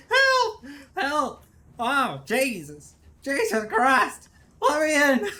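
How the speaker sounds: high, loud, drawn-out cries; loud, high-pitched pleading; panicked shouting. Real, not acted. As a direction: wail for rescue in a game panic. Player in danger, begging to get in.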